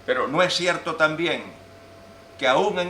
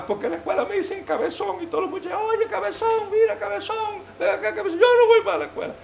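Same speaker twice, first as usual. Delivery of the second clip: put-on very high squeaky little boy, louder at the end; whiny child voice; 3 dB louder than usual. Crying boy repeats classmates' taunts to his mother in falsetto. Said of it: "el niño vino llorando".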